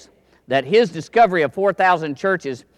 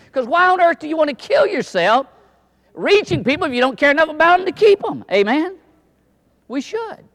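high, ringing preacher's appeal; climbing fervor, asking for an amen; fervent preaching; 10 semitones higher than usual. Real, not acted. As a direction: lift it up to the congregation. Preacher building to a peak.

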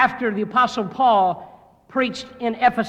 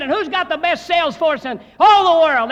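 higher, louder mock rally question; rallying manager; 7 semitones higher, 7 dB louder than usual. Sales manager firing up his salesmen, hammed-up rally shout. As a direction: pump up the room like a hammed-up sales boss. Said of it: "He said".

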